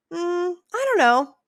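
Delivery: high, loud, drawn-out hedging; noncommittal. Acted. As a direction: stall with a slow, high, exaggerated reply. Acting out husband's noncommittal answer.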